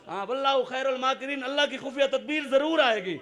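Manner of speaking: loud, high rallying oratory; fiery preaching. Acted. Preacher rallying the crowd in full voice. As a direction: rouse the crowd at full force. Rousing opening of the speech.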